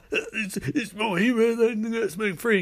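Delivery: high, slow, strained gasps; slow high-pitched dying words; mock dying voice. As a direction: play a hammed-up death scene, pitched high. Frozen Cyclops's dying words.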